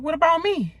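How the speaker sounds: imitating Dan's needy question; mocking his complaint. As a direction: parody his wounded ask. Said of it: "He was like".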